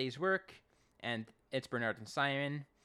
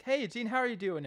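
Tom's greeting; 10 semitones higher, 4 dB louder than usual. Retold or imitated. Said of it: "Tom's like".

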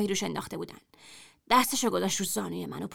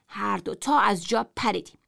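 ordinary delivery, nothing marked

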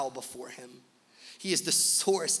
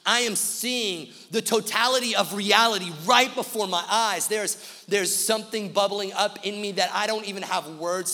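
louder, higher, driving sermon climax; climactic preaching; 5 semitones higher, 10 dB louder than usual. Acted. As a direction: build to the peak over the music. Climactic preaching over music.

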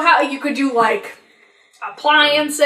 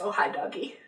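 whispered hi doggy; 3 semitones lower, 15 dB quieter than usual. Retold or imitated.

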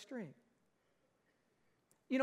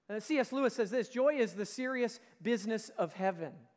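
louder, driving sermon voice; preaching at full force; 5 dB louder than usual. Acted. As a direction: build the call up for the church.

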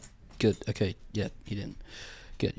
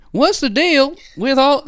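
Jerry Seinfeld impression; 6 semitones higher than usual. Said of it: "It's Southern Jerry Seinfeld".

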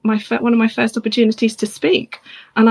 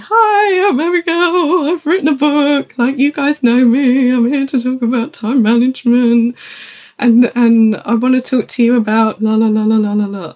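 shrinking nervous voice; 3 semitones higher than usual. Retold or imitated. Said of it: "my voice is getting quieter and quieter".

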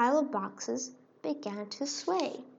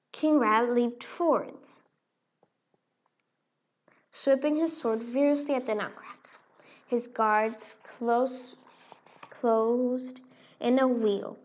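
usual speaking voice

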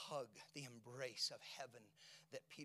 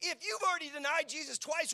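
high and loud, hammering the phrase; preached; 13 semitones higher, 13 dB louder than usual. Acted. Pastor pressing the congregation, loud preaching. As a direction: throw it out to the back row.

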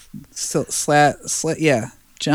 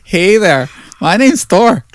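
louder, higher brash hero voice; mock Thor; 8 semitones higher, 5 dB louder than usual. Friends joking about Thor's accent, playing a brash Thor. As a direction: swagger like a dumb god.

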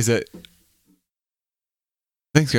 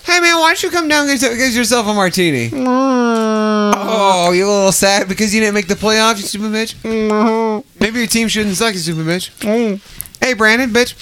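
mocking taunt; 7 semitones higher, slower than usual. Host taunting absent friend Brandon, mocking and high. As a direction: jeer at him like he can hear.

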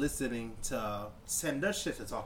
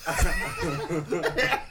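laughing through the words; can barely get the words out for laughing; 12 dB louder, much slower than usual. Real, not acted. Retelling the line to his co-host, laughing hard.

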